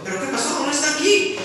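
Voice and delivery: ordinary talk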